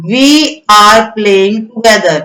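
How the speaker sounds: very slow sentence, word by word; slow dictation. Shown as a demonstration. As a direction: dictate it slowly to the class. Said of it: "rewrite the sentence properly". (धीरे-धीरे बोलकर लिखवाना)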